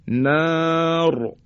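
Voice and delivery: "نار" with a long held alef and thick R; long vowel, heavy R. Shown as a demonstration. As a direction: hold the ا of "نار", then a heavy ر. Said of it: "از صداهای کشیده و مدی باشه". («نار» با الف کشیده و رای درشت)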